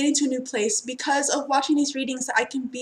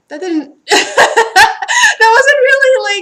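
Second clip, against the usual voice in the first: much higher and louder, slow, laughing hard through the words; real laughter; 13 semitones higher, 14 dB louder, much slower than usual. Real, not acted. Reader laughs at her childhood plans, real laughter. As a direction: break up laughing mid-sentence.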